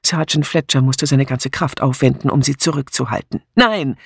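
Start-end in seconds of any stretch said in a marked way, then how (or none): 3.5-4.0 higher, urgent cry of refusal; called out. A narrator's reading. Sergeant holding Miss Hinchliff back, urgent call. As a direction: call out sharply to stop her. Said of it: "rief er".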